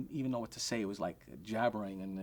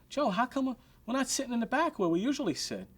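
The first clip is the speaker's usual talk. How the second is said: mock Billy Martin complaint; 12 semitones higher, 4 dB louder than usual.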